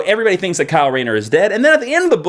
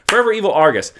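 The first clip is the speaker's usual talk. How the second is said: ordinary talk, nothing marked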